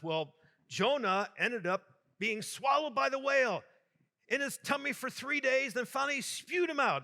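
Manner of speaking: put-on high, animated child telling a story; eager schoolgirl. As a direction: imitate a keen pupil, pitch climbing as the tale goes on. Eager pupil retells Bible story.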